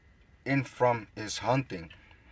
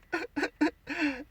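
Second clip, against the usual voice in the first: high amused laugh; 3 dB quieter than usual. Real, not acted. Laughing at his own mock.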